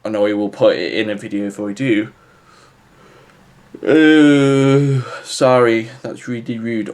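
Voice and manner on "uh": a loud real yawn breaking into the talk. Real, not acted. Vlogger yawns on camera while winding down, a real tired yawn. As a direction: let a tired yawn escape mid-sentence. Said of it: "I just yawned in a video".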